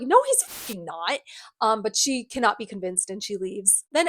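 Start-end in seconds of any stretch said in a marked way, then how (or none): 0.0-1.2 slow, deadpan, emphatic denial; flat retort. Retold or imitated. Jace telling the Inquisitor his father won't help, slow and deadpan. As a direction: lean on each word, unimpressed.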